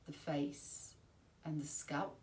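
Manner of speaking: ordinary delivery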